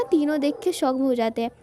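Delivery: ordinary talk, nothing marked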